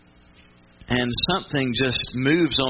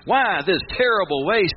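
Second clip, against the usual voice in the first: a mock outraged complaint, pitched up and drawn out; indignant Judas; 9 semitones higher, slower than usual. Judas objecting to the wasted perfume, high indignant protest. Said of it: "Judas speaks up and goes".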